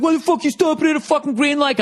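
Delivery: imitated irate driver, very high-pitched; high, strained mock road-rage rant; yelling back. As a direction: holler it back like the other driver. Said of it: "she starts yelling at the guy, and I'm yelling".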